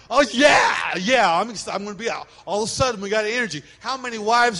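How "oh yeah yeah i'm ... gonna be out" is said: a hammed-up excited shout, louder; eager yes. Husband answers his buddy's fishing call, mock-eager. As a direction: jump at the fishing trip. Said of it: "if someone calls you".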